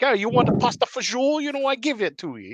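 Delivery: put-on high, fast Jersey Italian accent; playful Jersey Italian accent voice; Italian American accent. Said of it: "a Jersey Italian accent".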